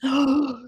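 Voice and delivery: quick, sharp intake of breath; sharp gasp. Retold or imitated. Husband realizing something came home, sharp gasp.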